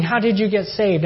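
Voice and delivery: high-pitched eager question; amazed questioning. Retold or imitated. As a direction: imitate astonished friends wanting to know. Friends amazed at his conversion.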